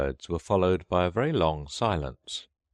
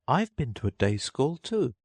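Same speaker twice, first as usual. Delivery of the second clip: put-on high, proud little girl; Alice voice; 9 semitones higher than usual. A narrator's reading. Alice matching the turtle's schooling, high and girlish. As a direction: pipe up as a child with a boast.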